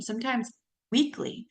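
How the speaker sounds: ordinary delivery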